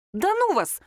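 high, huffy dismissal; snorting. A narrator's reading. Lera to her teasing friends, snorting. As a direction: brush them off with a huff. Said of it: "фыркнула Лера".

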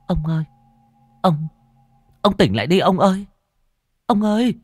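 high-pitched, urgent woman's voice; anxious high calling; wife waking husband. A narrator's reading. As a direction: call him awake, high and insistent. Wife urgently wakes her husband.

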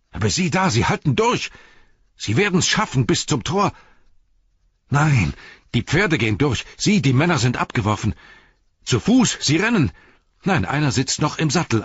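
high, urgent, breaking news as it happens; agitated eyewitness cries. A narrator's reading. Beregond watching his lord's men attacked, high agitated cries. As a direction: call out each turn of the chase, high and alarmed.

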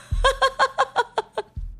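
risata altissima e calante; risata acuta. Real, not acted. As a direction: lascia andare la risata. Ride dopo il pensiero. (risata acuta e divertita)

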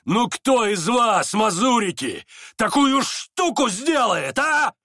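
high, loud, drawn-out boast; exultant challenge. A narrator's reading. Рогожин challenges the company, loud and exultant. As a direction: throw it out as a loud dare. Rogozhin challenges everyone, beside himself.

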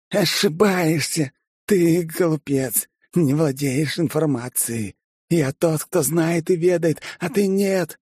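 high, slow gloating lunatic; menacing madman. A narrator's reading. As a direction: drawl your contempt at them. (зловещий безумец)

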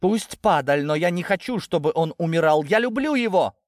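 high, pleading cry; impassioned outcry. A narrator's reading. Natasha confessing her love to her parents, impassioned outcry. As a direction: build to a desperate high cry. Daughter pleading in a family quarrel.

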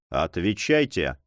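a low, slow, cold order; cold command. Acted. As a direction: give the order icily, without raising the voice. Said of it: "холодно приказал Монбар". (холодный приказ)

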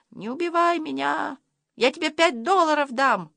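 high, pleading cry without real volume; storyteller's high-pitched scared boy; frightened cry. A narrator's reading. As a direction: beg for your life in a high voice. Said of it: "закричал".

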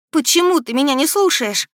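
high, loud, pleading question; desperate reproach. A narrator's reading. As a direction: demand it in despair. Desperate heroine confronts prince. (с отчаянным упрёком)

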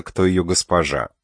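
usual speaking voice

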